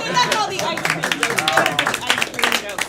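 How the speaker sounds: loud, drawn-out emcee call; host's closing shout. Real, not acted. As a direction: holler it over the applause. Host calls out round's end.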